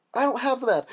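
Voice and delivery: high-pitched mock plea; hammed-up high denial; protesting captive. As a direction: protest to your pretend captors. Captive answers pirates' demand.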